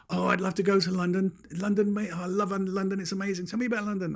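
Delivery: high-pitched breathless-fan chatter; hammed-up enthusiasm; mock gushing admirers. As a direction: imitate strangers raving eagerly. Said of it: "They said".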